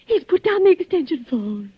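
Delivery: ordinary talk, nothing marked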